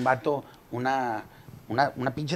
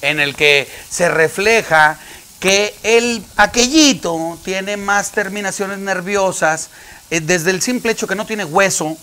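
mock solemn doctor; 6 semitones higher, 7 dB louder than usual. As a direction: explain it gravely like a doctor. Doctor explaining new exam.

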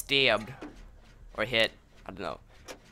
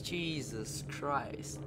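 very slow and much softer drawn out; exasperated sigh; 12 dB quieter, much slower than usual. Real, not acted. Gamer worn out by a teammate's blunder, slow soft exasperation. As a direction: let it drain out, defeated.

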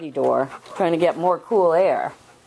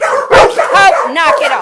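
loud, higher stern command; scolding shout; 7 semitones higher, 10 dB louder, much slower than usual. Real, not acted. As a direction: bark the order at the dog. Scolding the dog Hope.

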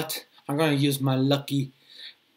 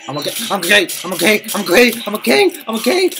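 higher and louder, speeding up at the end; panicked reassurance; 6 semitones higher, 4 dB louder, faster than usual. Real, not acted. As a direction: talk yourself down in rising panic. Panicking under zombie attack.

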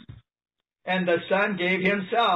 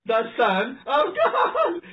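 emphatic; 6 semitones higher, slower than usual. Real, not acted. Preacher drives home the repeated title.

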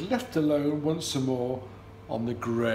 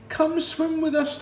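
high, louder swan voice calling out; 4 dB louder than usual. Acted. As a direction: invite him kindly from across the water. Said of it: "they called".

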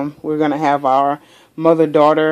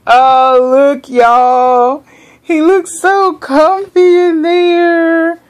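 high sing-song cooing, a bit louder; high cooing gush; 9 semitones higher, 4 dB louder, much slower than usual. Real, not acted. Cooing at doll in seat.

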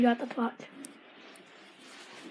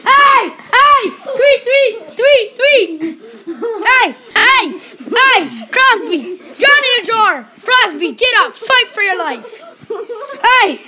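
loud, higher calls breaking up a scrap; shouting ref; 5 semitones higher, 9 dB louder, slower than usual. Real, not acted. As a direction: yell the players' numbers and names over the noise. Refereeing a scuffle, shouting names.

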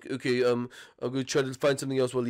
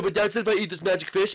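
usual speaking voice

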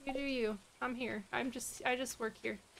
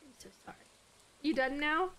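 ordinary delivery

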